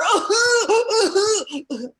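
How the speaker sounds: very high, loud hammed-up sob and laugh; mock sobbing. Comic performing the sobber to the audience, high loud mock wail. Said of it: "the sobber".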